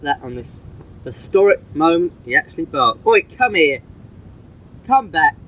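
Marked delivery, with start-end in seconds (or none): 3.1-5.4 calling the dog. Real, not acted. Owner calling the dog back, higher call. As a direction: call him back across the room.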